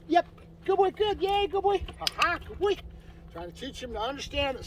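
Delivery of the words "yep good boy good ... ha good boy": high, loud happy praise for the dog; higher and louder praise to rev up the dog; excited praise. Real, not acted. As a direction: cheer the dog on with a bright laugh.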